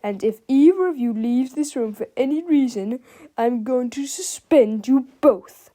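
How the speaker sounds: evil-grinned threat, slowing at the end; Mister Krupp. A narrator's reading. Krupp warns the boys not to leave, with evil relish. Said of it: "said with an evil grin".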